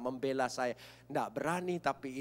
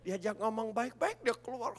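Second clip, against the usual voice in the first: ordinary talk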